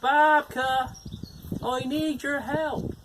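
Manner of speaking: high, slow call across the garden; old man calling for his wife. Acted. Old man calls his wife over to help, calling out loud. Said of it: "So he called out to his wife".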